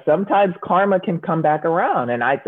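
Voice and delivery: normal talking voice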